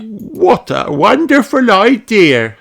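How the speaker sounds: high, slow, delighted rabbit; delighted Miss Rabbit. Acted. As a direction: savour the idea.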